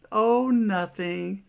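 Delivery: high, drawn-out mock-innocent dismissal; brushing it off. Nurse dodging the patient's question, airily dismissive. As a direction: imitate someone evading, slow and high-pitched.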